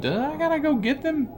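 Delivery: silly high-pitched whine; squeaky high voice. Pretend. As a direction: squeak it like a reluctant kid. Reading game dialogue aloud.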